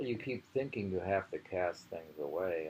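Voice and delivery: normal talking voice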